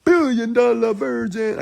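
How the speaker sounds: high, stretched-out announcer call; drawn-out mock MC shout-out; club MC hype. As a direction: imitate a hype man on the mic. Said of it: "And on the mic, he said".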